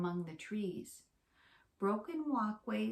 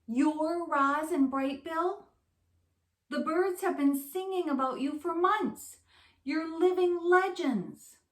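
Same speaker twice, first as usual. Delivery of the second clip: squeaky excitement; 7 semitones higher, 8 dB louder than usual. A narrator's reading. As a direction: gush like meeting your heroes. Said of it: "the voice squeaked with excitement".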